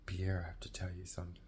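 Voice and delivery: low hushed voice telling a secret. Retold or imitated. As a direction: confide it quietly, keep it low. Sister confides secret quietly.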